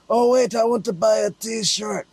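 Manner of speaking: high, slow mock fan voice; lightly put-on fan voice; Croatian fan. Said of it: "they say".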